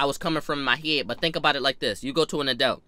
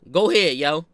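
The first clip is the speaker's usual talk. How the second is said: ordinary delivery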